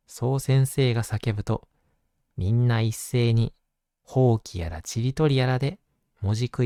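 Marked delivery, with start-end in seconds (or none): none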